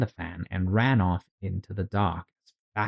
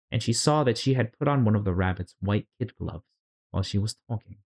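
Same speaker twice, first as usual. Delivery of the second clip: usual speaking voice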